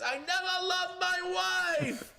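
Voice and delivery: high, drawn-out mocking shout; mock confession wail. Salesman mocking Al before his buddies, long high wail. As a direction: wail it high and slow, imitating him. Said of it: "you were like this".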